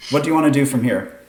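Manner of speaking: ordinary delivery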